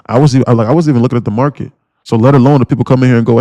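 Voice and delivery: ordinary delivery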